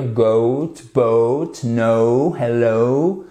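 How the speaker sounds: each word ends on a gliding oh-oo diphthong; diphthong models. Shown as a demonstration. Teacher runs through the diphthong words for learners. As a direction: glide the oh in "Goat", "boat", "no", "hello". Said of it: "un double son".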